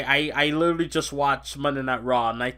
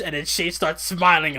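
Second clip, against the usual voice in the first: ordinary delivery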